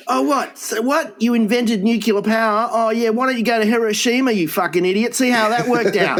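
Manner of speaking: high, sneering mock rant; mock berating. Berating Einstein at the imagined wedding, high mock rant. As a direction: tear into him in a high put-on voice.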